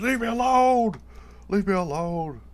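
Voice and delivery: very high, hammed-up cry for peace; mock desperate yell. Acting out being hounded by cats.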